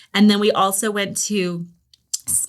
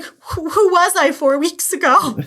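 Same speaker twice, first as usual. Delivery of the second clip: much higher, slow, amused imitation; mock bewildered teen; 10 semitones higher, 4 dB louder than usual. Mother imitating her son's high, slow, baffled excuse. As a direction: squeak it out like a baffled kid. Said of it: "he was like".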